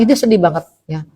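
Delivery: ordinary delivery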